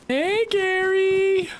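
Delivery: put-on high, slow baby-talk greeting; cute baby voice. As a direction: coo it sweetly like a baby zombie. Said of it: "Cute baby Zed voice".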